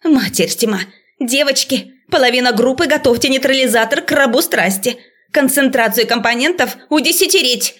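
высокий взволнованный голос преподавательницы; командуй торопливо, в панике; паническая команда. A narrator's reading. (встревоженно, высоко, с напором)

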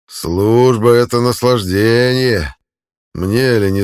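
loud, slow gloating taunt; mocking taunt. A narrator's reading. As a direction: gloat over him, slowly. Centurion taunting the beaten man.

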